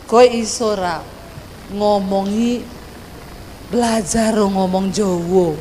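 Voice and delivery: low, softened Javanese intonation; Javanese husband's tone. Retold or imitated. Husband asks wife to learn Javanese, in low Javanese tone. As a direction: Javanese lilt, pitch dropping at the end. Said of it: "Itu nadanya orang Jawa".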